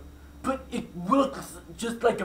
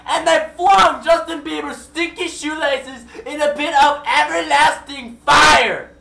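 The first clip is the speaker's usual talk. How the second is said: slow, much louder and higher, building to a shout; excited climax; 9 semitones higher, 13 dB louder, slower than usual. A narrator's reading. Narrator shouts the story's climax to the listener, slow and much louder. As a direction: roar out the finale like an epic showdown.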